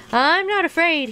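high-pitched cry of false bravado; frightened cry. A narrator's reading. Shaking Toad insists after fleeing the snake, frightened cry. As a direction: cry it out high, putting on bravery. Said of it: "he cried".

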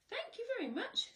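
high polite cake voice; squeaky delighted thank you. Acted.

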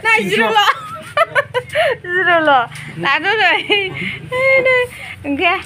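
high, loud laughing protest no no. Real, not acted. Friend protesting loudly to the others, high and loud. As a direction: shout the refusal over them.

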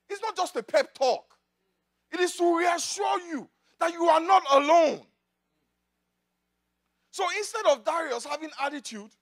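ordinary talk, nothing marked

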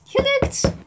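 high, loud triumphant cry; excited cheer. Acted. Wand heals the leg, staff announces success excitedly. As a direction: burst out in delight. Magic spell works.

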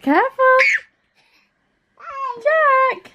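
high call across the house. Real, not acted. Mother calling son from afar.

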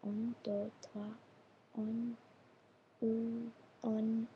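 very soft under-the-breath count; counting to oneself. Real, not acted. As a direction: quietly test the numbers to yourself. Counting to herself to remember.

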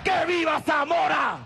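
shouted rally chant, pitched high; ranting dictator speech. Real, not acted. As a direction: bellow each slogan to the crowd. (arenga gritada a todo pulmón)

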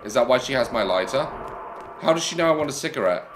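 ordinary talk, nothing marked

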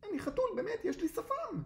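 very high, panicked cat voice; terrified little squeal of protest; horrified. A narrator's reading. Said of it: "קרמר נחרד".

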